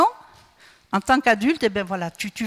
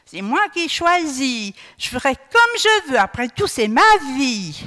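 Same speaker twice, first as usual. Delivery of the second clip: loud, high-pitched wilful protest, key words drawn out; capricious outburst; 5 semitones higher, 6 dB louder, slower than usual. Acted. Preacher mimicking a capricious adult insisting on their own choices, wilful and defiant. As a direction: act out a spoiled tantrum, voice pushed up and loud. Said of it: "tu continues à faire des choix capricieux".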